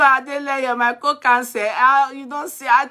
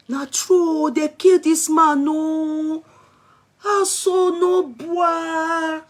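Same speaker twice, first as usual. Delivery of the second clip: higher, long drawn-out lament; real dismayed wail; 4 semitones higher, much slower than usual. Real, not acted. Streamer learning the killing is true, wailing. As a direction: stretch the last words into a wail. Reacting to news of deadly attack.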